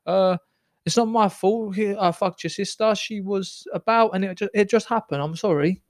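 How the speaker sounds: pleading, Italian-tinged Maltese; playful Maltese accent demo. Shown as a demonstration. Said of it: "Do a Maltese accent".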